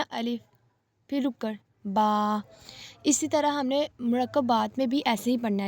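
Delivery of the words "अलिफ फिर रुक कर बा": अलिफ, then a pause, then बा, each letter said apart; separate letters. Shown as a demonstration. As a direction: pause after अलिफ before बा. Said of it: "अलग-अलग पढ़े थे ना". (अलिफ और बा को रुक रुक कर अलग-अलग)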